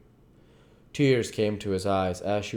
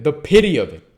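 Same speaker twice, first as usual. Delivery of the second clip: louder, higher lament; anguished cry; 7 semitones higher, 12 dB louder than usual. A narrator's reading. Dorian lamenting his changing portrait, loudly anguished. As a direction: cry out the pity.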